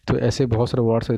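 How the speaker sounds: normal talking voice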